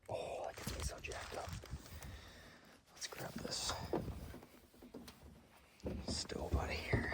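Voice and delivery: hushed whisper, slowing at the end; excited whisper. Real, not acted. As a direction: whisper so the birds don't hear. Whispering near gobbling turkeys.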